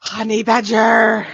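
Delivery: drawn-out mock holler; hammed-up announcing shout; playful shout. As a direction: call it out slow and loud. Jokey shouted animal name.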